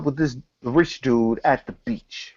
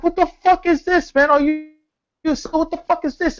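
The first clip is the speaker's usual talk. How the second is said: much higher, rushing panicked outrage; outraged poor dude; 12 semitones higher than usual. Retold or imitated. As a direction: sputter like someone just dunked underwater. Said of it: "the poor dude's like".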